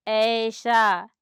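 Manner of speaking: "ऐसा" said very slowly, first vowel held; long vowel drawn out. Shown as a demonstration. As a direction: stretch the "ऐ" of "ऐसा" for the class. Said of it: "इसमें time थोड़ा लगेगा". ("ऐसा" को बहुत धीरे, लंबा खींचकर)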